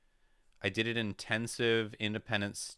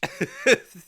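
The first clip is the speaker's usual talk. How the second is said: burst of laughter; 13 dB louder than usual. Real, not acted. Laughs at own French joke.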